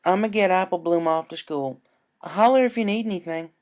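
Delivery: cheerful country call; Applejack voice. Acted. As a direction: holler it out friendly.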